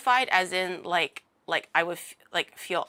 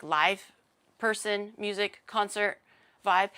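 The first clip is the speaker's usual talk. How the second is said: ordinary talk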